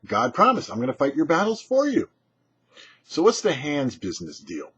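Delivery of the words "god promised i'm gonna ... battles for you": ordinary talk, nothing marked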